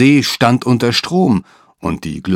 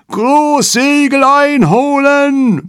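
viel höher und lauter, langsam gebrüllt; über das Deck brüllen; brüllend; 5 dB louder, much slower than usual. A narrator's reading. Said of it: "brüllte er über das Deck". (lauter Befehlsruf)

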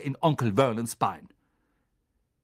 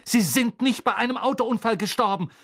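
angry; 8 semitones higher, 4 dB louder than usual. A narrator's reading. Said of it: "sagte Harry".

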